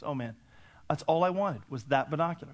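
normal talking voice

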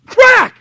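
high, explosive shouted crack; whip crack. Retold or imitated. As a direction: imitate the whip snapping loudly. Imitates the son's whip crack.

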